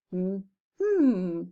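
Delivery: two hummed beats, one per syllable; humming the beats. Shown as a demonstration. Teacher hums out the syllables of winter for the class, short steady beats. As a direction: hum each syllable as a short beat. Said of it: "and it's to hum it".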